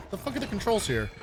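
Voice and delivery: normal talking voice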